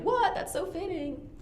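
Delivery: high sarcastic What, then dry; mock surprise. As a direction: feign shock then deadpan. Imagined audience reaction.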